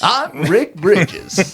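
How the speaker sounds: slow, drawn-out anchorman delivery; news anchor voice. Pretend. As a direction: stretch it like a TV anchor. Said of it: "sound like a news anchor".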